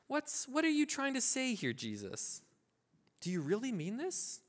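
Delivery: high, hesitant mock questioning; acted doubting. Believer questions Jesus's real meaning, acted uneasy doubt. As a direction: question it gingerly, swooping up in pitch. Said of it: "I wanna justify it to be like".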